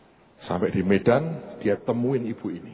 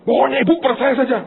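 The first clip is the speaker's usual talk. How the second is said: high, loud and fast insistence; insistent loud urging; 9 dB louder, faster than usual. Retold or imitated. Rich friend pressing the widow to trust, loud and fast. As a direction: push her to just believe, loud and quick.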